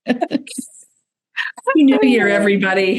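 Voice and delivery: festive greeting. Real, not acted. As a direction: stretch out a cheery New Year greeting. Host greets listeners for New Year.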